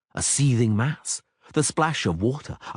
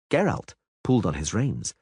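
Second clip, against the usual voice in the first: usual speaking voice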